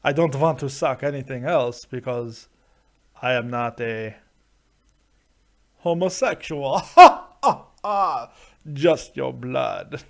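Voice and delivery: hammy Transylvanian count with a mock evil laugh; Dracula accent. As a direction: play a cackling Transylvanian vampire. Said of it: "with a Transylvanian Dracula accent".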